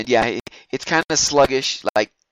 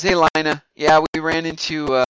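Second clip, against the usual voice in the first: louder, higher hail; lightly acted call-out, pitched up; Matt calling to her; 5 semitones higher, 5 dB louder than usual. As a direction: call out a greeting to someone across the yard. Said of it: "Matt calls her and says".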